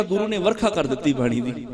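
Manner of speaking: ordinary talk, nothing marked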